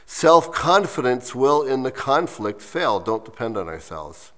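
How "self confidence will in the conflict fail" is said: slow, deliberate line of verse; measured poem recital; slow reading. Real, not acted. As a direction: read it out slowly, word by word. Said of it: "read it to you slowly".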